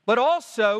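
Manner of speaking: normal talking voice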